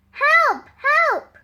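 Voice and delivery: high, loud panicked shout; fake cry for help. Sam fooling the villagers, loud high fake alarm. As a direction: yell for help as if terrified.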